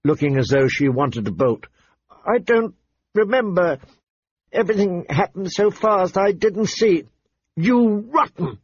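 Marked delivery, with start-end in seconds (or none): 2.3-7.1 high-pitched, tearful excuse. A narrator's reading. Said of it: "she said tearfully". 7.6-8.6 high, drawn-out furious accusation; shrieked. A narrator's reading. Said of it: "Arya shrieked".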